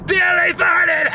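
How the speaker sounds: high, slow, laughing shout; blurting it out through helpless laughter. Real, not acted. Boy in the nativity play, cracking up at the fart, shouting through laughter.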